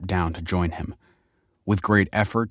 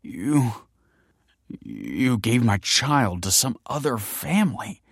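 halting, slow, higher-pitched disbelief; stammering; 5 semitones higher, slower than usual. A narrator's reading. Michael realises she gave his child away, stammering. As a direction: stumble on the first word, stunned. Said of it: "stammered Michael".

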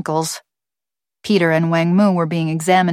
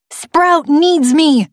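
put-on high, slow girl's shout; loud, drawn-out high protest; 10 semitones higher, 5 dB louder, much slower than usual. A narrator's reading. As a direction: shout it as a desperate child's plea. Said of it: "shouted Thulium".

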